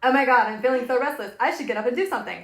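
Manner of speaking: fast, high, loud frantic inner voice; hammed-up panicky self-talk; mock frantic self. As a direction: rattle it off like a restless self. Mocking her restless inner voice.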